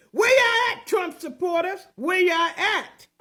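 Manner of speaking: loud taunting call to the crowd; shouting taunt at absent supporters. Real, not acted. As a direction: holler it out, mocking. Calling out to Trump supporters.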